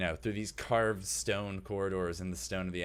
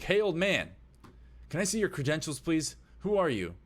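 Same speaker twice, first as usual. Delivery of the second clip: high, loud challenge from a guard; guard calling out; 9 semitones higher, 5 dB louder than usual. Acted. Guard to old man a hundred feet away, calling out. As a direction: call down the hallway to a stranger. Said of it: "You see one of the guards".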